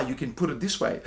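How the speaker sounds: usual speaking voice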